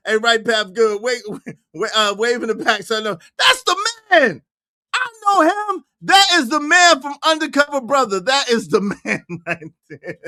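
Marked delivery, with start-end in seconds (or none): 3.4-8.2 high-pitched excited exclamation; amazed and insistent, pitched high; astonished recognition. Real, not acted. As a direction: call out the discovery, voice way up. Exclaims recognizing film character.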